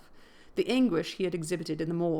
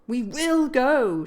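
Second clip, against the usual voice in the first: slow, loud, higher, wrenching the words out; straining insistence; 6 semitones higher, 9 dB louder, much slower than usual. A narrator's reading. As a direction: force it out while struggling. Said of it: "she repeated, exerting her utmost efforts".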